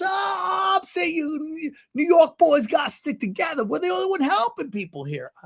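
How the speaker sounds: put-on high-pitched old man bonding; rally a fellow New Yorker, pitched high; excitable older man. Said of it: "It's how he talks".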